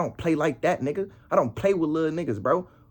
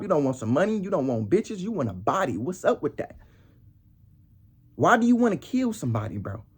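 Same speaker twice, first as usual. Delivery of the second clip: normal talking voice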